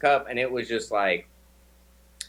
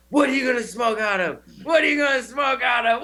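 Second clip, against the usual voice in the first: from raised to a much higher shout, louder each repeat; building mock yell; 8 semitones higher, 3 dB louder than usual. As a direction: escalate the question over train noise, ending in a yell. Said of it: "And they're like".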